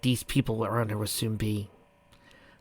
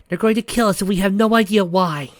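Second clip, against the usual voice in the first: panicked; 9 semitones higher, 8 dB louder than usual. Acted. Panicked character line in story.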